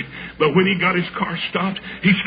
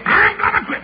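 high-pitched mimicked pastor; Duck; 3 dB louder than usual. Pastor telling a young evangelist he is resigning, high mimicked voice. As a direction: pipe it up high as a mock declaration.

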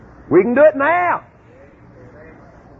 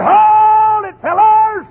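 loud, higher shouted hold it; calling out; 10 semitones higher, 5 dB louder, much slower than usual. Retold or imitated. Two men shouting at the fleeing spies to stop. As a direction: yell for the fellas to stop. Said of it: "They said".

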